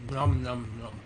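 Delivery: chewing while talking; munch on chocolate; mouth full. Real, not acted. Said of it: "I'm eating some chocolate".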